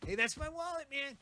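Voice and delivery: usual speaking voice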